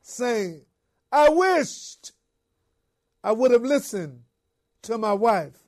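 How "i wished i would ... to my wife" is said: crying out. Retold or imitated. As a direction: wail it out, full of regret. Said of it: "cried out".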